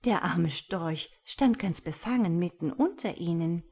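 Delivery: ordinary delivery, nothing marked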